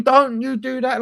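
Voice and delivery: high-pitched imitation of a player arguing back; mock retort. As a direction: play-act an indignant comeback. Imagined player arguing back at manager.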